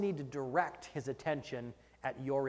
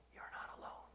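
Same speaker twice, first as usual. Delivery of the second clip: whisper; 19 dB quieter than usual. Acted. God's quiet reassurance to the listener.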